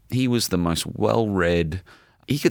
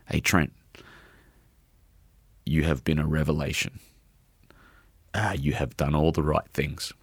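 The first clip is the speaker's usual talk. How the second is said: emotional reading; 3 dB quieter than usual. Real, not acted. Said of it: "I can't even get it out".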